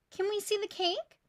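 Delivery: put-on high, bright child voice asking; eager kids. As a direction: ask like a curious child. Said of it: "the students asked".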